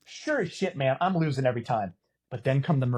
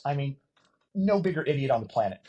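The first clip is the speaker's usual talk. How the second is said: ordinary talk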